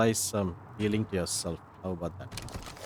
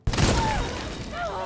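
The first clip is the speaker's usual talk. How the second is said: dramatic gasp. Real, not acted. Shock at killing own clone.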